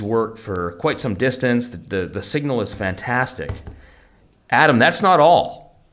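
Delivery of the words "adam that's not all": infomercial pitch. Pretend. Infomercial-style reveal.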